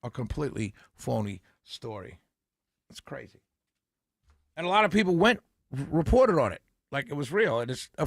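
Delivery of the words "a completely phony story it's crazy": a hint of the president, lower and softer; lightly voiced president. Host echoing the president to listeners, light mock imitation. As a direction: echo the president's dismissive phrase in a light imitation. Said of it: "according to the president".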